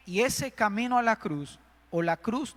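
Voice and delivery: ordinary delivery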